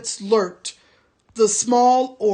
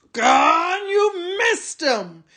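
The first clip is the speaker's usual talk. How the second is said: higher, jeering shout; mocking orc cry; 8 semitones higher, slower than usual. A narrator's reading. Tracker jeering at the soldier, shouting. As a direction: crow at his failure. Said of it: "said the tracker".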